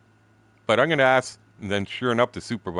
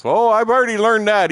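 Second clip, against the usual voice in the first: excited quote; 13 semitones higher, 10 dB louder than usual. Retold or imitated. Guy reacting to brother's story.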